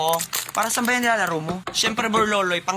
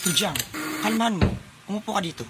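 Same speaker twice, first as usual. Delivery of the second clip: usual speaking voice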